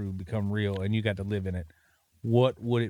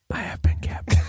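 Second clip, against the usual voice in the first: whisper yelling. Pretend. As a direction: push the whisper hard. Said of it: "It's called A S M R".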